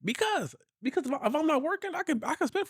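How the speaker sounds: high-pitched, emphatic; excited high rant. Real, not acted. Husband telling wife what he'd do, pitched high. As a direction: push it up with glee.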